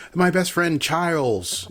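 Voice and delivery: "Charles" with a long y vowel, like "Chyles"; mock fantasy name. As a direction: slowly stretch "Charles" into silly "Chyles". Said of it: "change the A to a Y".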